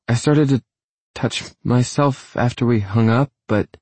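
embarrassed woman losing her nerve; hesitate, then let it trail away. A narrator's reading. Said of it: "She trails off".

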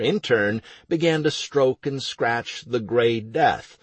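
plain reading voice

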